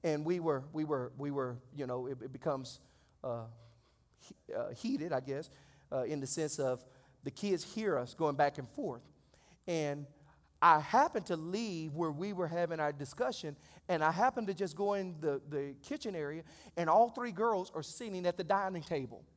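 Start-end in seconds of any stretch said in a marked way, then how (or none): none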